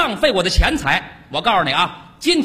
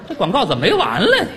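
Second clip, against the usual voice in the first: ordinary delivery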